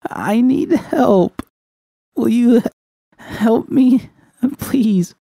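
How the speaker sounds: high, slow, breaking plea through a held-back sob; choked plea. Acted. Judy finally begs V for help, choking on a sob. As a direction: beg for help barely holding back tears. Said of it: "I choked back a sob".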